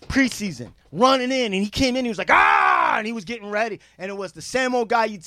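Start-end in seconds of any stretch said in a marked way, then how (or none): 2.3-3.0 pumped-up yell. Retold or imitated. Imitating pumped-up player.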